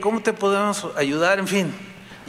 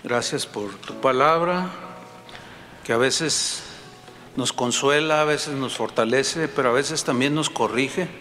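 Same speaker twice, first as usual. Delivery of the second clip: closing prayer; 4 semitones lower than usual. Acted. Pastor leading the congregation in prayer, humble and earnest. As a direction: pray humbly to God, dropping at each Señor.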